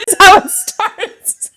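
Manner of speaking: breaking up, high and slow through giggles; can't hold it together, laughing. Real, not acted. Trying to read a poem but cracking up at the co-host. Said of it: "get through this without laughing".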